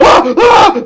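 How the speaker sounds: high, sharp shrieks; two quick frightened cries; startled screams. Real, not acted. Startled by hits in game.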